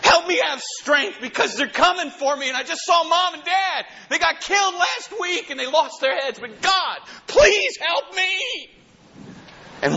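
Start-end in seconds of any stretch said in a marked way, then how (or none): 0.0-8.7 high, crying plea that swells into a shout; desperate pleading prayer. Retold or imitated. Hiding believer crying out to God in a cave, high desperate plea. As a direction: beg God as if they're coming for you. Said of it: "They've been crying in caves and saying".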